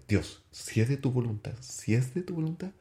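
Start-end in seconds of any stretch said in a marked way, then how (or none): 1.6-2.7 pleading prayer. Retold or imitated. Praying on the stairs for the girl, pleading higher. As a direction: repeat it higher, pleading, rising at the end. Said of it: "y le pido a Dios".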